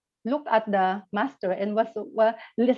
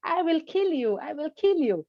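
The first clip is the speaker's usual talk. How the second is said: imitating an angry husband, pitched higher; 7 semitones higher than usual. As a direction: acts out a furious husband lightly. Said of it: "the husband was so angry".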